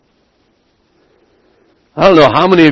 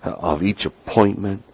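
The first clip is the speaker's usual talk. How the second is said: earnest prayer; 6 semitones lower, 9 dB quieter than usual. Real, not acted. Leading the congregation in prayer for Pastor Jim, hushed and reverent. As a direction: pray quietly, leaving space between phrases.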